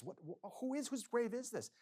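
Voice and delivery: high, startled Hamlet asking; a stunned prince's high cry; shocked question. Acted. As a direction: jolt up in alarm at the grave.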